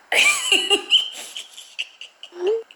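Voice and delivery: squealing laugh; high-pitched laugh. Real, not acted. Laughing at the prank.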